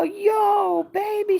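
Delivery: very high, loud mimicked hello; imitated excited greeting. Mother greeting her at the door, imitated excited greeting. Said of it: "She go".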